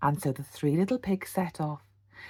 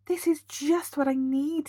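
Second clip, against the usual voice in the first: higher, pleased; delighted; 9 semitones higher than usual. A narrator's reading. Percy finds the brickyard, delighted. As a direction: discover it with joy. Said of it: "he said".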